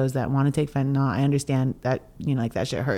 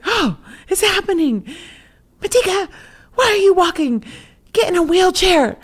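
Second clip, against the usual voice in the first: much higher, louder bossy auntie ordering; Aunt Lorraine; 7 dB louder than usual. Retold or imitated. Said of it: "My bossy auntie".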